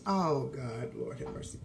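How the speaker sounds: very low, hushed muttered prayer; real dismay, barely voiced; dismayed aside. Real, not acted. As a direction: mutter it under your breath, dropping away. Dismayed aside at mace rumour.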